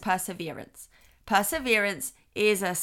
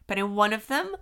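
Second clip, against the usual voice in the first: normal talking voice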